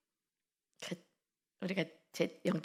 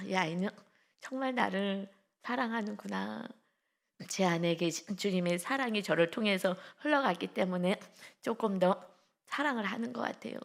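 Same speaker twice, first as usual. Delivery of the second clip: normal talking voice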